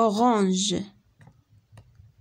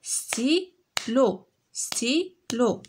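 ستيلو said slowly as sti-lo, two separate beats; syllables clapped out; much slower than usual. Shown as a demonstration. Teacher to pupils, counting syllables: ستيلو split slowly into sti-lo. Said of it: "دو سيلاب".

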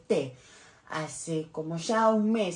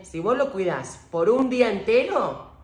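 ordinary talk

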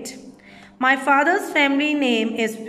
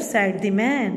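ordinary delivery

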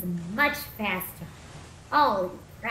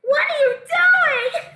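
much higher, louder and slow; what are you doing; 9 dB louder, much slower than usual. Real, not acted. Gamer stunned by the game's behaviour, loud disbelief. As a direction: cry it out in disbelief. Shocked at game.

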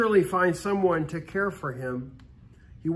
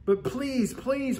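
higher, softer pleading; a hungry boy begging at the door, pitched up and soft; 6 semitones higher, 3 dB quieter, slower than usual. Acted. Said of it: "pleaded John".

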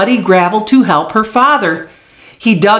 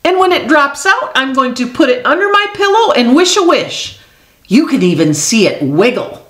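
excited; 7 semitones higher, faster than usual. Acted. Excited child telling her secret.

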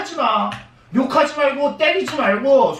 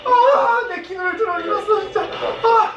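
overjoyed shouting; 9 semitones higher than usual. Real, not acted. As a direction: scream with real relief. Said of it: "어, 내 귀 아파, 놀랐다".

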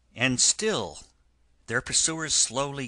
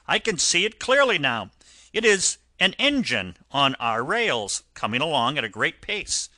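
higher, louder call from a lookout, easing off; raised voice reporting from above; 4 semitones higher, 6 dB louder than usual. A narrator's reading. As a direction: call out the sighting, strong at first. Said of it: "Presently he called out".

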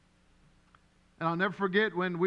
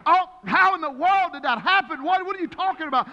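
high, loud stunned outburst; like the news just knocked you flat; shocked disbelief; 8 semitones higher, 6 dB louder than usual. Retold or imitated. Pastor reliving hearing his wife is pregnant, high loud shock. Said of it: "I went".

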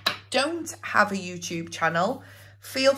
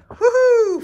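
high, loud woohoo; excited cheer; 14 semitones higher, 8 dB louder than usual. Real, not acted. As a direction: whoop with joy. Cheering for the prize.

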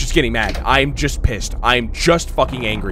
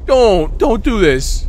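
panicky plea; 8 semitones higher, 5 dB louder, slower than usual. Real, not acted. Pleading with the game.

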